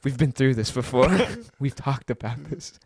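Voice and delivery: mock-serious deep self-talk; mirror pep talk. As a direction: coach yourself gravely, drawing the words out. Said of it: "the mirror Sean's like".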